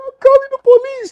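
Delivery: very high, loud cry; panicked mock shriek. Woman threatening police and man begging no, high panicked shouting. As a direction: imitate a hysterical threat and a screamed refusal.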